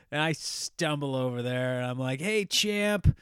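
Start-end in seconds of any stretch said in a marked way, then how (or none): 2.2-3.2 higher hail to a champion; tipsy greeting. Retold or imitated. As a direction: call out like a tipsy fan. Drunk greeting after stumbling over.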